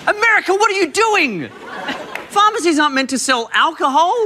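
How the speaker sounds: high, exasperated disbelief; incredulous. Real, not acted. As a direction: ask in mock disbelief. Mock outrage at pharmacy.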